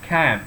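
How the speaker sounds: "cab" with a long English æ instead of a plain A; "cab" with changed A. Shown as a demonstration. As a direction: open the vowel of "cab" towards eh. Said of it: "A-nya dibaca I". ("cab" dengan A panjang dibaca æ)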